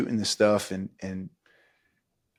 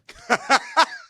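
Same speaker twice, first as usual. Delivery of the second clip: high burst of laughter; 4 dB louder than usual. Real, not acted. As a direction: laughs out loud at the memory. Laughing at the memory.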